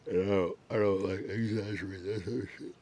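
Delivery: talking through a yawn; words stretched by a yawn. Real, not acted. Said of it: "Damn, I'm yawning like a bitch".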